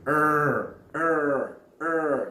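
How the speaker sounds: ER held like an angry dog's bark; loud, drawn-out ER with a heavy r; exaggerated ER sound. Shown as a demonstration. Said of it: "just like a dog when there is an angry".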